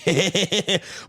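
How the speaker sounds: high giggly chuckle; amused laughter; laughing. Real, not acted. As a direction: let out a run of chuckles. Joking about the resource room.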